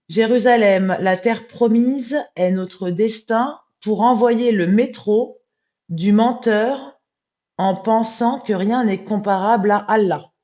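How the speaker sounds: loud, high, slow declamation; solemn text recitation. Real, not acted. Rereading the corrected text to viewers, loud, high, slow recitation.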